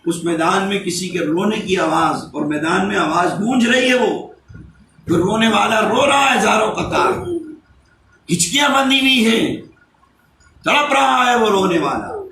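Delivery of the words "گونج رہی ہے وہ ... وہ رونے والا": raised, high-pitched sermon delivery; impassioned preaching. Acted. Preacher telling listeners of someone weeping uncontrollably, impassioned.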